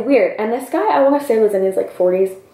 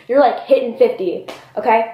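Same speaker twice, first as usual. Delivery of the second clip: usual speaking voice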